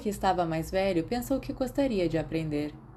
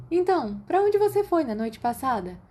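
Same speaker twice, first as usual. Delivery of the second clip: calling over running water; 8 semitones higher, 3 dB louder than usual. A narrator's reading. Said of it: "Lily chamou acima do barulho de água corrente".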